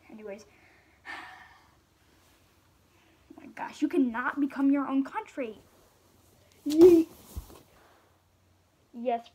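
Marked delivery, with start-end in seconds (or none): 1.1-3.8 whisper. Acted. Shocked aside to audience. 6.7-7.1 shout. Acted. Throwing the tea.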